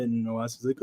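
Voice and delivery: ordinary talk, nothing marked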